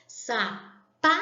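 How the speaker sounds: "Sapato" split slowly, middle syllable pa hit hardest; stress on PA. Shown as a demonstration. Teacher showing the strongest syllable, "Sapato" with forceful pa. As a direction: punch the pa in "Sapato", pausing between syllables. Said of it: "é pronunciada com maior intensidade, com mais força".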